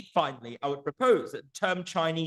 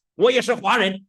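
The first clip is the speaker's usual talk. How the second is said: loud, higher-pitched proud announcement to a crowd; Jensen Huang declaration; 7 semitones higher, 7 dB louder than usual. Retold or imitated. Jensen Huang tells a room full of Chinese he is one too, loud declaration. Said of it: "He says".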